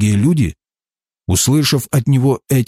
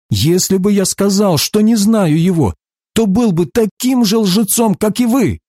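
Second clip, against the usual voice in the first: high-pitched, insistent argument; controlled narrated indignation; emphatic rebuttal; 8 semitones higher than usual. A narrator's reading. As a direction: drive the accusation home, pitch climbing. Heated rebuttal accusing them of lying.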